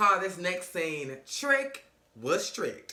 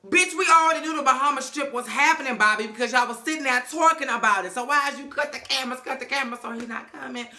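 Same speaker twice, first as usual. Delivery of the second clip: higher and louder, incredulous; exasperated rant; 7 semitones higher, 5 dB louder than usual. Real, not acted. Recapper scolds Bobby about the Bahamas trip, higher and louder. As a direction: throw your hands up at him.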